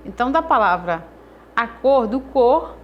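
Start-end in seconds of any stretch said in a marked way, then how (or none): 1.6-2.8 "cor" said louder, as the strong syllable; stressed syllable. Shown as a demonstration. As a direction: lean hard on "cor". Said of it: "a sílaba que eu pronuncio com mais força". ("cor" pronunciado com mais força)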